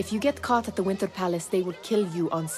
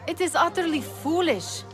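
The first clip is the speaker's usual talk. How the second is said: exasperated scolding; 9 semitones higher than usual. Acted. Friend scolds his risky plan.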